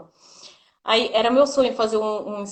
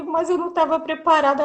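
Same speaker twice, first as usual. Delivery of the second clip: higher, lightly voiced plea; own past protest; 7 semitones higher than usual. Gi tells her friend she wasn't ready, higher mild plea. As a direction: whine it like your tearful younger self.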